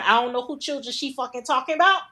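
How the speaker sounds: normal talking voice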